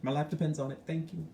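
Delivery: a caller keeping it light and soft with a Republican father; real easy voice. Shown as a demonstration. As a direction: coax your father gently, all love and no pressure. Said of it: "talk like this".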